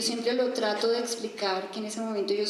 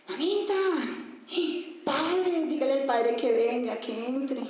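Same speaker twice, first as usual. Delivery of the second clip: retold shout; 5 semitones higher than usual. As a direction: call out urgently to mother for the priest. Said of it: "empiezo a gritar".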